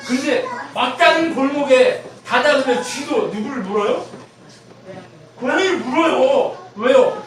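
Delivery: loud, high-pitched sermon delivery; impassioned preaching. Real, not acted. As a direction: drive the point home at full force. Preaches at full force.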